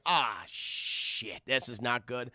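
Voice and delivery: deflated and low; low dismay. Acted. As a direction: play a mild "I'm in trouble" groan. Student dismayed by demerit.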